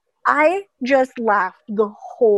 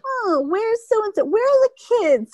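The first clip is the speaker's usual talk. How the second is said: grandma; 12 semitones higher than usual. Retold or imitated. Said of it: "It was just like".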